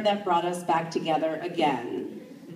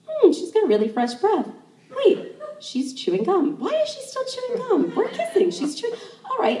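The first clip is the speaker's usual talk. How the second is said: puzzled inner voice; 12 semitones higher than usual. Retold or imitated. Inner thoughts during kiss, puzzled.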